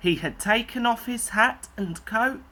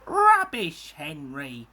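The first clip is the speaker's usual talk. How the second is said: scornful snort; 3 semitones lower than usual. A narrator's reading. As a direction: brush him off with a snort. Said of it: "snorted James".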